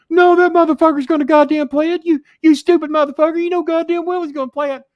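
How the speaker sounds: hammed-up screaming Jimmy impression; mock Jimmy Cornette rant. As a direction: shriek the rant like an enraged Jimmy. Jimmy ranting in retold call.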